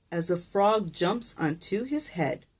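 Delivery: ordinary delivery, nothing marked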